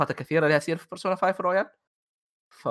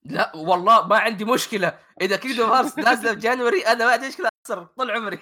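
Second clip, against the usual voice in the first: high, excited, laughing it off; 7 semitones higher, 3 dB louder than usual. Real, not acted. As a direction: gush about the release date. Eager about Kingdom Hearts release. (صوت متحمس عالي)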